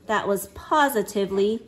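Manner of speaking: ordinary delivery, nothing marked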